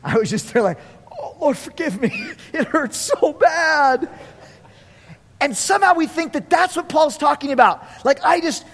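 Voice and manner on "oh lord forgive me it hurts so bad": higher-pitched mock agony, dragging the end; a hammed-up, pitiful prayer with a laugh; pained pleading. Glutton begging the Lord after overeating, pained pleading.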